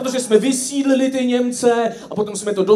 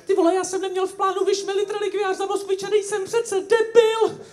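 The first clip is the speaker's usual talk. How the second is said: much higher, fast mocking whine; Hamáček whining; 11 semitones higher, faster than usual. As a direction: whine it fast in a squeaky caricature voice. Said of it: "než Hamáček do telefonu zakňourá". (vysoké, rychlé posměšné kňourání)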